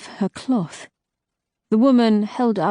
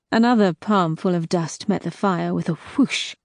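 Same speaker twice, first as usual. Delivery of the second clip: usual speaking voice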